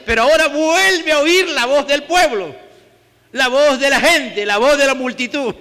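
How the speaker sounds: fervent sermon climax; preaching at full force. Acted. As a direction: drive the point home, rising and loud. Preaching crowd pressure loudly.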